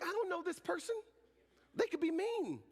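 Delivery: very high, hammed-up tearful outburst; mock crying. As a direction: squeal through pretend happy tears, building to a wail. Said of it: "I'm crying".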